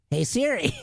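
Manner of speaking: a playful take on how his wife talks to Siri; impersonating his wife. Retold or imitated. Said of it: "I'm not too far off in my impersonation of her".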